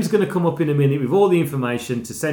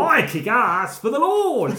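slow, higher mock preacher line; hammed-up movie quote; 8 semitones higher, slower than usual. Quoting the film to his co-host, slow hammed-up zealot. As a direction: drag it out like a hammy zealot. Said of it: "he's always like that".

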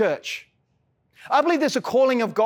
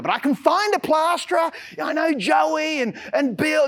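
excited protest; 6 semitones higher than usual. Retold or imitated. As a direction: pitch jumps higher, he half shouts his retold protest. Retelling argument with pastor.

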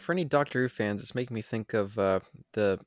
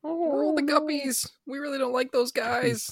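high cartoon voice. Dubbing a character on screen.

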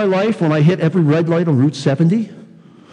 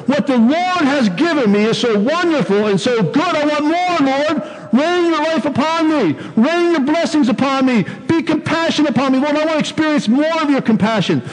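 fervent prayer; 9 semitones higher than usual. Acted. Voicing the psalmist's fervent prayer.

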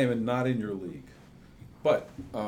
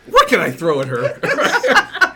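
loud, laughing mock indignation; laughing outrage; 9 semitones higher, 14 dB louder than usual. Real, not acted. Host laughing loudly at a guest who was also a baritone, mock outrage. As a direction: shout it through laughter.

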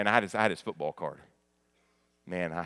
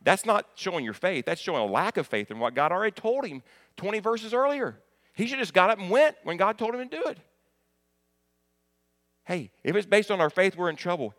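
much higher, forceful pulpit voice; impassioned preaching; 9 semitones higher, 5 dB louder than usual. Real, not acted. Preacher correcting Gideon's lack of faith, impassioned preaching. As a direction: drive it home high and loud.

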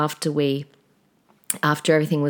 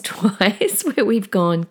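usual speaking voice

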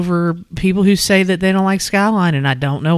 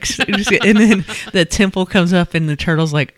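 ordinary talk, nothing marked

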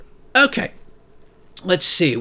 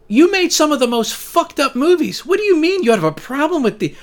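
incredulous rant; 7 semitones higher than usual. Real, not acted. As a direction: tear into him like he's lost his mind. Ranting at Wes Craven's complaint.

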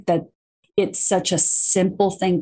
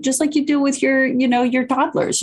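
raised pitch; 7 semitones higher than usual. Retold or imitated. Voicing a parent with toddlers.

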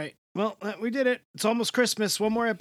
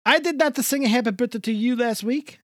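put-on thick Jersey Italian accent; Italian-American accent; 5 semitones higher than usual. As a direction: play a warm old Italian uncle. Held Italian-American character voice.

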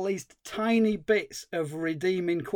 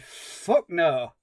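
retold retort. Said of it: "out loud, I said".

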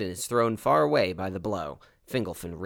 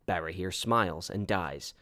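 plain reading voice

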